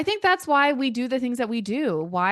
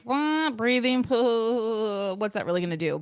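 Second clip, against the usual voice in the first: put-on scoffing old self; 4 semitones higher than usual. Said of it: "I used to be like".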